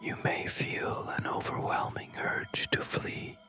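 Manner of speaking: plain reading voice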